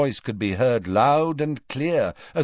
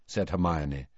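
ordinary delivery, nothing marked

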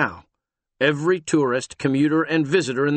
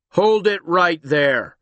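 loud, slow commanding shout; 5 semitones higher, 5 dB louder, slower than usual. Acted. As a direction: shout the order. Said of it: "The shout stopped them".

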